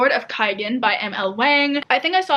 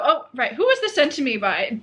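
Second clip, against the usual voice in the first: ordinary talk, nothing marked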